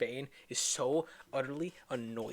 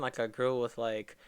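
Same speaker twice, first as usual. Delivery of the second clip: usual speaking voice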